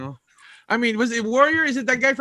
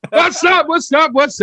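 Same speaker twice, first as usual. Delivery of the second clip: excited shout; 6 semitones higher, 5 dB louder, slower than usual. Real, not acted.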